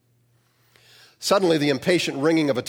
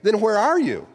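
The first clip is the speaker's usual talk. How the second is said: much higher, louder startled question; surprised; 9 semitones higher, 4 dB louder than usual. A narrator's reading. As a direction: jump up in surprise. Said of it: "In surprise".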